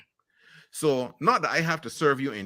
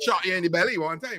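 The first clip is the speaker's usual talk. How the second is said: laughing; 7 semitones higher than usual. Real, not acted. Laughing over the gunman joke.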